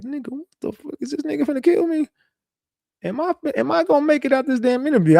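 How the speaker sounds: hammed-up shock, am I getting out alive; mock panicked thought. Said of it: "I'm like".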